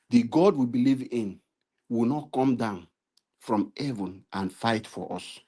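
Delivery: normal talking voice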